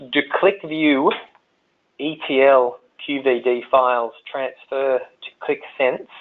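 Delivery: slow question with long pauses; careful, slow read-out of a question; read slowly. Real, not acted. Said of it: "I'll read it slowly there".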